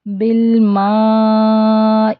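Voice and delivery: slow melodic Arabic recitation; slow chanted dua; Quran-style recitation. Shown as a demonstration. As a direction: recite slowly with tajweed. Recites phrase.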